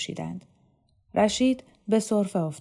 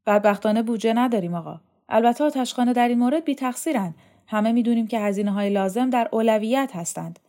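quick, higher, louder official making excuses; self-justifying official; 4 semitones higher, 5 dB louder, faster than usual. Acted. Dormitory chief defends his record to an inspector, self-justifying. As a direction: plead your case with formal politeness, briskly.

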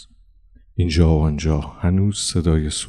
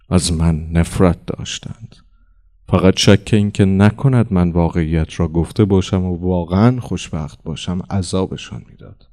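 ordinary delivery

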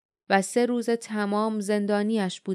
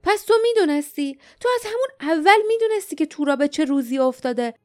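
higher and louder, accusing; harsh, angry; 6 semitones higher, 4 dB louder than usual. A narrator's reading. Dain accusing Dom of lying, harsh angry outburst. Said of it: "داین با خشونت گفت".

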